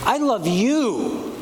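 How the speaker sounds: love slow and lifted, the whole line stretched out; tender, slowed-down declaration; stress on love, slow. Shown as a demonstration. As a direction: draw out love slowly with two peaks. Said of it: "three very different meanings".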